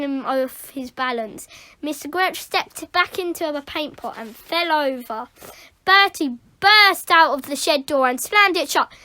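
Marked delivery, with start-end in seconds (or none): none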